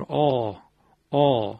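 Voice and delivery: آ as a dark, heavy 'aa' made in the throat; thick throaty vowel. Shown as a demonstration. Teacher showing where the heaviness comes from, thick throaty vowel. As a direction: hold آ open and full from the throat.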